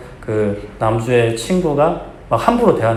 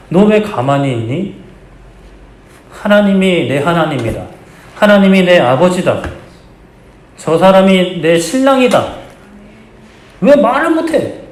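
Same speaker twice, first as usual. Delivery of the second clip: slow, higher, louder impassioned cry as God; God pleading; 7 semitones higher, 5 dB louder, slower than usual. Acted. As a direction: beat your chest and demand they speak up. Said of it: "가슴을 치면서 말씀하시는 거예요".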